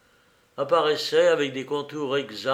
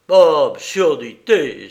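loud, drawn-out exclamation of revolt; indignant outcry; 10 dB louder, slower than usual. A narrator's reading. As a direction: burst out in sudden protest, stretching the words. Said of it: "s'écria-t-il".

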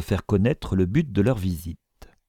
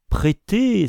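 high-pitched outcry of the usurer; shocked exclamation; 12 semitones higher, 4 dB louder than usual. A narrator's reading. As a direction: cry out, aghast at the request. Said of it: "s'écria l'usurier". (cri scandalisé)

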